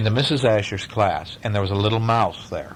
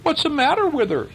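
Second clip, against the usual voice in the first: put-on high child's question; a curious boy asking the teacher; lightly voiced schoolboy; pupil. A narrator's reading. Pupil Roy Hart asks.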